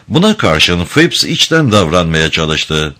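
plain reading voice

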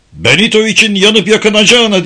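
high, loud outburst; furious shout. Acted. Alvar snapping at Fred, loud furious shout. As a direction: explode at him. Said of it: "diye bağırdı Alvar".

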